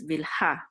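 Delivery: Vilha with the weight on a final HA; stress on last syllable. Shown as a demonstration. Teacher giving the Hebrew form, Vilha stressed on HA. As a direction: lean on the HA of Vilha, Hebrew style. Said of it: "In Hebrew it's".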